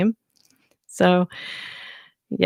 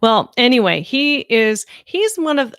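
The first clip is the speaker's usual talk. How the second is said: ordinary talk, nothing marked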